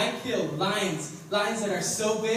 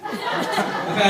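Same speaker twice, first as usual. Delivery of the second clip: loud laughing yell; half-laughing demo shout; 7 dB louder than usual. Shown as a demonstration. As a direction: show off a big yell. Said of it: "when I yell, I sound like".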